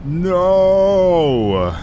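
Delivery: loud, high cry of dismay; anguished no. Real, not acted. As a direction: wail no in protest. Servers going down soon.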